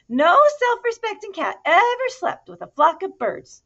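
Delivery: high, loud motherly scolding. Acted. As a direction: tell him off like a fed-up mum. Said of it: "she scolded".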